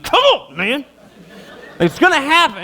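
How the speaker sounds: high, mock-threatening dad; mock stern dad. Dad waving the spoon at his son, mock threatening. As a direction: tease the threat with a laugh.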